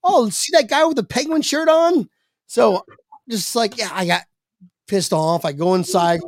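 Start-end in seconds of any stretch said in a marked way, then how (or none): 0.0-2.1 high mocking call; mocking onlooker. Onlookers mocking the guy in the penguin shirt. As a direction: point him out to laugh at him. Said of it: "and they go".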